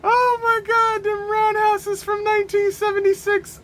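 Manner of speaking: high-pitched, helpless mirth; laughing squeal. Real, not acted. Reviewer gushing over dated roundhouse kicks, high and laughing. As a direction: push the words out in a high squeal.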